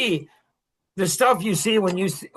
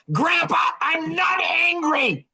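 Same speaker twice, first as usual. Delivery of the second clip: put-on three-year-old granddaughter; 9 semitones higher, slower than usual. Granddaughter protesting to Grandpa, high tearful shout. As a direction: play the upset toddler shouting back. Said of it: "she's like".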